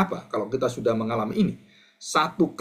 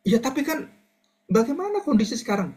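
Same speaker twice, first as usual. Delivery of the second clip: much higher, pleading objection; whining doubter; 10 semitones higher than usual. Acted. Believer protesting to God, high whiny voice. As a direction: push back at God like a doubting child.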